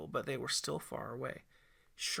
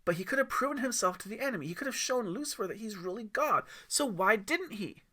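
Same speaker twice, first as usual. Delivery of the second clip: put-on kid voice; 7 semitones higher, 3 dB louder than usual. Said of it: "Aiden said".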